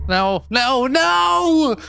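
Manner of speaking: rising into a desperate yell; panicked shout. Acted. Lerv wird festgehalten, schreit.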